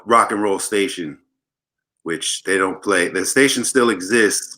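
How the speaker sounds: usual speaking voice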